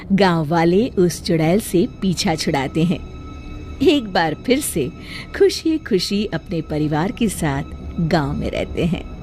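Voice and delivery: ordinary delivery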